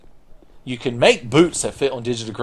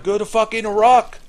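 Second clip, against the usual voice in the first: put-on voice, pitched way up; Miyazaki; 10 semitones higher than usual. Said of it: "and he's like".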